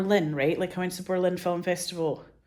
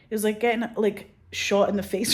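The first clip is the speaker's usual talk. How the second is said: usual speaking voice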